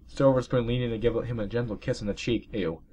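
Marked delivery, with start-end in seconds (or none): none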